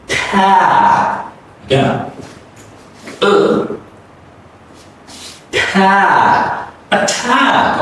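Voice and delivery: attack with the second A stretched, mouth wide open. Shown as a demonstration. Teacher exaggerating the vowel for learners, drawn out. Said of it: "your mouth is widely open".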